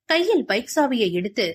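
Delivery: ordinary delivery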